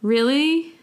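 gee, really?; surprised really. Shown as a demonstration.

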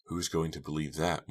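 plain reading voice

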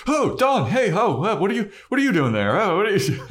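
high, loud, fast mock fluster; hammed-up startled fake greeting; flustered, caught out. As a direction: babble like you've been caught, overdoing it. Acting out Buffy caught talking, flustered.

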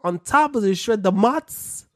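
loud, high Italian cook voice; mock Italian cook. Cook explaining the pizza, loud mock Italian. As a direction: boss around like an old-school Italian cook.

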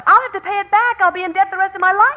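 high and loud in distress; panicking about the debt; panicked. Acted. Panicked over losing money.

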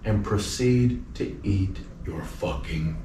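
slow, low, measured threat; deadly calm, word by word; quiet menace. Retold or imitated. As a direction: threaten softly, pausing between phrases. Retells his cold threat to the player.

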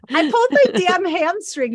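loud, high, laughing outburst; laughing exclamation. Real, not acted. Host confesses her mishap to friends, laughing loudly. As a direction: burst it out through real laughter.

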